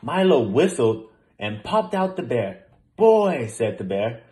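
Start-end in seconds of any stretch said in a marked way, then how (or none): 3.0-3.5 relieved. A narrator's reading. Bear relieved to see Milo.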